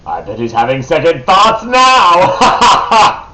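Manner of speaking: loud, slow, laughing taunt; laughing gloat. Real, not acted. Gloating laugh over enemy.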